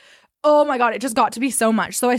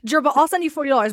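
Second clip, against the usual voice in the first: laughing through the line, a bit quicker; 4 semitones higher, faster than usual. Retold or imitated. Retelling to her co-host how she gave in, laughing. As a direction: retell the offer while cracking up.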